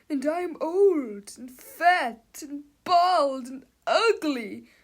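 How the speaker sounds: loud, high, slow lament; anguished cry. A narrator's reading. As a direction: wail over your own body. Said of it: "cried the guy who looked".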